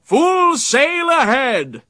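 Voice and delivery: high, long-drawn captain's command; rousing seafaring holler; sailor's cry. A narrator's reading. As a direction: call it out across the deck, stretching each word. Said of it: "he cried".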